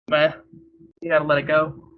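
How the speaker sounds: normal talking voice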